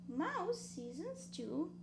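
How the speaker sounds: ordinary delivery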